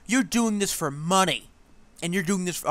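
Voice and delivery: imitating an irate viewer yelling accusations; mock angry yelling. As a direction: ham up the furious commenter's shout. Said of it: "he was very angry".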